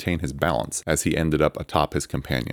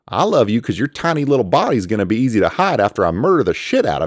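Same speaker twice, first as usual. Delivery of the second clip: mock threat shouted; 7 semitones higher, 6 dB louder than usual.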